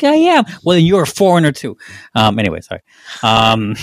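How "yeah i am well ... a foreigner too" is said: much higher squeaky mock voice; mock other person. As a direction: parody the person who said it. Mimicking the exchange.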